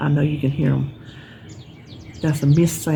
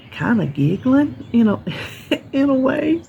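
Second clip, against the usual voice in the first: high, amused, with a laugh breaking in; real giggle midway through; 8 semitones higher than usual. Real, not acted. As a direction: chuckle through it as you recall it. Chuckles while recalling the giggling girl.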